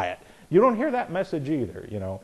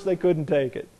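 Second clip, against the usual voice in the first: ordinary delivery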